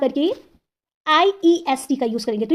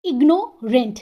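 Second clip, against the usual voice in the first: Ignorant with a pause before rent; deliberate two-part ignorant; broken into syllables; slower than usual. Shown as a demonstration. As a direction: split ignorant into two beats. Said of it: "यहाँ पे भी टू syllables हैं".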